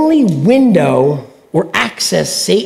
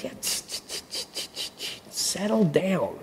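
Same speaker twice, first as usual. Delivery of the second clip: whispered shushing, much softer; calm shushing; 2 semitones lower, 17 dB quieter than usual. Retold or imitated. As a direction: hush a frightened boy gently. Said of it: "Elisha's like".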